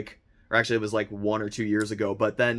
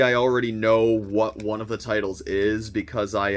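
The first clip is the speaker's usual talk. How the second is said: ordinary talk, nothing marked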